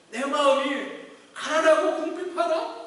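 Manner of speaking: loud, high, impassioned preaching; fervent sermon climax, rising and loud; preaching at full force. Acted. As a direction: drive it home to the congregation. Preaching resumes.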